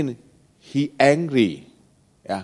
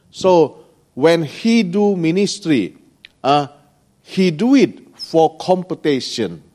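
ordinary talk

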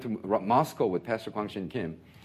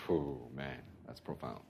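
soft aside; 8 semitones lower, 12 dB quieter than usual. Real, not acted. Quiet aside on the teaching.

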